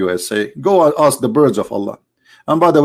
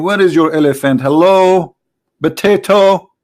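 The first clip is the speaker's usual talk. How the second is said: imitated yelling, rising higher and louder at the end; mock scream; 4 semitones higher, 4 dB louder than usual. Man calling out in the square of the Kaaba, mock scream. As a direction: call out mockingly, building to the last word. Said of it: "He was screaming in the middle".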